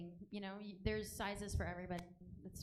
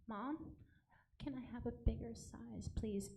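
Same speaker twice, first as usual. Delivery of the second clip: softly, shyly asking; barely voiced request; quiet timid ask; 7 dB quieter than usual. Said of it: "Speak up, I can't hear you".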